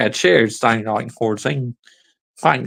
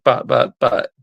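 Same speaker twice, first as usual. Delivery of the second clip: mock stammering protest. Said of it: "Gonna say".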